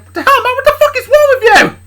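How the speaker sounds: high, loud screamed challenge; outraged yell. Acted. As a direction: shout him down in outrage. Charlie confronts the angry dad.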